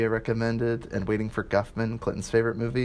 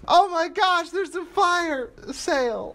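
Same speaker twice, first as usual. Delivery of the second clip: very high, drawn-out panicked movie quote; hammed-up quote; slower than usual. Quoting David Cross to podcast friends, hammed-up panic. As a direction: squeal it in mock alarm, stretching the end. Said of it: "I've quoted that".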